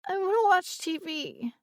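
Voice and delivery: put-on high little girl whine; whiny child. A narrator's reading. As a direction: whine like a sulky toddler. Child whining.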